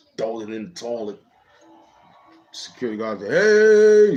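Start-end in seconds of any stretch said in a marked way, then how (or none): 3.3-4.2 much higher, much louder mock yell; guard's shout. Security guard startling kids in the bathroom, loud yell.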